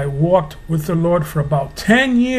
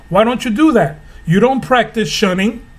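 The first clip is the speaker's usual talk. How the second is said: ordinary talk, nothing marked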